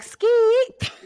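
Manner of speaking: high, loud rap ad-lib yell; mock ad-lib shout. Daughter shouting an ad-lib back at her mom's rapping, high and loud. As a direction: belt it out like a rapper's ad-lib. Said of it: "I be like".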